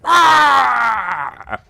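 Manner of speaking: very high, loud cartoon "Wah!"; accused Nixon answers as Wario, loud cartoon shriek. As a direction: squawk it like a cornered Wario. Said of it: "and he'd be like".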